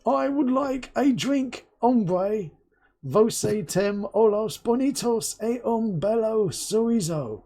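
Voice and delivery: mock English tourist in Portugal speaking slowly; loud English tourist. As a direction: ham up a clumsy Brit abroad addressing a waiter. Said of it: "the Englishman on holiday ... really loudly".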